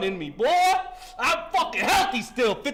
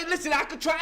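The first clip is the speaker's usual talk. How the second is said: usual speaking voice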